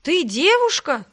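high, slow, loud disbelief; dumbfounded. A narrator's reading. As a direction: draw out the stunned question. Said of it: "ошарашенно произнёс второй голос".

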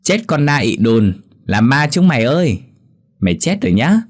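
a lightly voiced kid taunting in play; playful kid's shout; child's playful voice. Kids playing ghost game.

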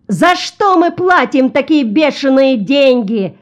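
high, outraged passenger's yell; indignant yelling. A narrator's reading. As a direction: yell like a cheated customer. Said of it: "заорал маленький коротконогий человек".

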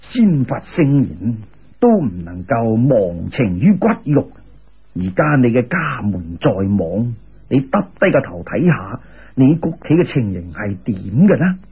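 solemn immortal. A narrator's reading. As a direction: deliver it deep and weighty like a wise old immortal. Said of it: "嗰位仙人话".

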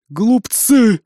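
loud, booming giant's cry; booming giantess voice. Acted. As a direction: boom it across the valley like a titan. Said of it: "разнёсся голос великанши".

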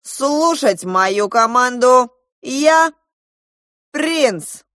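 high, loud proclamation; imperious prince. A narrator's reading. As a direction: command them regally, word by word. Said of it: "властно скомандовал принц".

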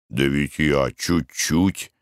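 low, slow, sheepish excuse; sheepish husband. Acted. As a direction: mumble an excuse. Husband justifying himself.

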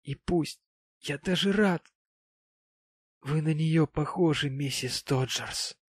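mildly despairing, pitch lifted. A narrator's reading. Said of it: "в отчаянии махнув на всё рукой".

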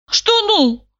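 high, outraged question; indignant. Acted. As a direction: fire it back, indignant. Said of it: "возмутилась я".

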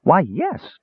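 high, excited kender; light up with excited agreement; high-pitched character excitement. A narrator's reading. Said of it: "Tass said excitedly".

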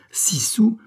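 very high, rising outraged repeat; exclamation outrée. A narrator's reading. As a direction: throw it back in high-pitched disbelief. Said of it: "s'écria la tante". (répétition outrée, très aiguë)

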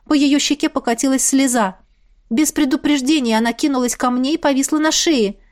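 plain reading voice

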